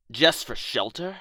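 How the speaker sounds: ordinary talk, nothing marked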